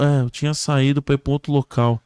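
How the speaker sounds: matter-of-fact echo of the question; victim answering. Acted. Crash victim answering the rescuer, plainly echoing.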